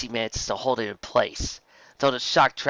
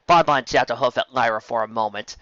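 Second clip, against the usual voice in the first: ordinary delivery, nothing marked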